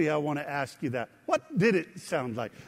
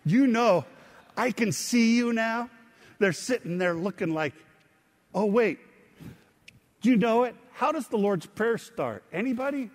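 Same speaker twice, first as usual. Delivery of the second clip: calling out; 5 semitones higher, 4 dB louder than usual. Real, not acted. Preacher calling out to congregation.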